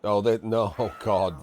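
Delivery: ordinary talk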